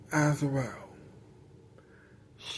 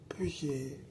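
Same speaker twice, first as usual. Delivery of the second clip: whispered; 4 semitones lower, 14 dB quieter, faster than usual. Acted. Whispered aside after thanks.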